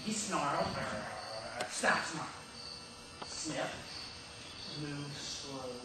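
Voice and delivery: soft and low; hushed suspense. Acted. [name] re-enacting the dog creeping to the meat, hushed suspense. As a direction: drop right down, stalking the moment. Acting out the dog's wary approach.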